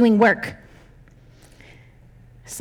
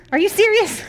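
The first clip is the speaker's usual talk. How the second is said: laughing disbelief; 10 semitones higher than usual. Real, not acted.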